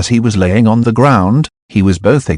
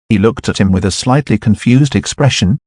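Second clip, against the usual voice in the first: ordinary delivery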